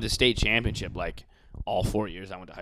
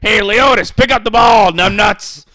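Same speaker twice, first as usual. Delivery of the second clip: much higher and louder, shouting coach; Alex Jones-style coach yelling; 11 semitones higher, 16 dB louder, slower than usual. Retold or imitated. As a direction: scream at the fumbling player. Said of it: "he talked like this".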